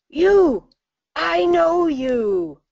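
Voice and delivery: a sudden, high, loud call of recognition, the second line slow and drawn out; pointing and shouting "You!"; startled shout. Retold or imitated. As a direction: call out across the street at a face you know. Stranger shouts from van window.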